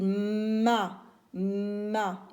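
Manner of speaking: "ma" with a long humming M before the A; first sound held. Shown as a demonstration. Teacher showing the sound at the start, long M in "ma". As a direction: hum the M, then open into "ma". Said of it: "J'entends me au début".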